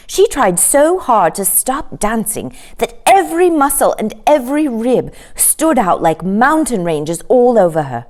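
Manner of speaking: ordinary delivery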